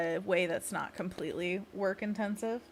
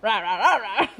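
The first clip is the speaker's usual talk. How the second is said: louder, laughing monster rah; monster growl; 9 dB louder than usual. Shown as a demonstration. Streamer leading the fourth pose, laughing monster roar. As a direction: giggle through a silly monster roar. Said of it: "And then curl 'em in".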